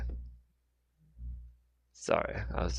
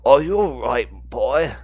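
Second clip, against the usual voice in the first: loud, raised call in an elder agent's voice; elder British agent; 6 semitones higher, 9 dB louder than usual. A narrator's reading. British agent to a shivering boy, elder British agent calling out. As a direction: call out a firm check on the child.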